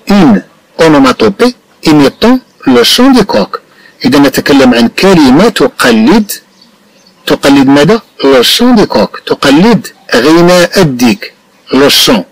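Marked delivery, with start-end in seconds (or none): 0.1-3.6 slow model reading. Shown as a demonstration. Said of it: "teacher models the French sentence". 8.2-9.2 slow model reading. Shown as a demonstration. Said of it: "teacher models the phrase".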